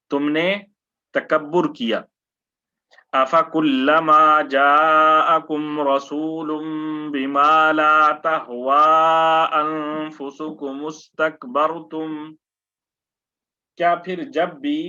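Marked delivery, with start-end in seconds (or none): 3.1-12.1 Quran recitation. Acted. Teacher recites the verse for his students in melodic tilawat.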